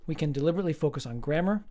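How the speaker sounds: ordinary talk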